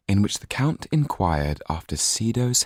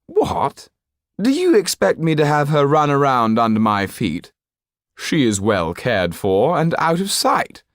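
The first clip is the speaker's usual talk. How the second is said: high, loud, disdainful aristocrat; a cold, petulant nobleman brushing off the lawyer; haughty Marquis; 10 semitones higher, 5 dB louder than usual. A narrator's reading. Marquis voiced by narrator.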